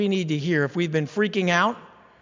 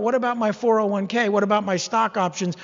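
louder, higher, fretful mock whine; mock self-centered whining; 4 semitones higher, 5 dB louder than usual. Preacher mocking a worrier fretting over money, loud mock whine. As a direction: whine like someone obsessed with their own stuff. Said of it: "you're so busy thinking about".

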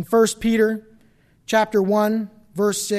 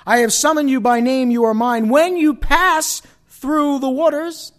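loud, higher preaching voice; scripture proclamation; 6 semitones higher, 8 dB louder than usual. A narrator's reading. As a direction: proclaim the promise loudly, drawing out key phrases.

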